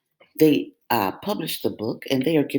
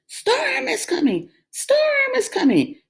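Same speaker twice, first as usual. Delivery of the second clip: very high bleating sheep voice. A narrator's reading. Frightened sheep bleat the warning. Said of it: "the sheep bleated".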